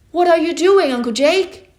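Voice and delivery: high, loud anxious call. Acted. Boy calls out across the river to his uncle, loud worried call. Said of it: "I called in a loud voice".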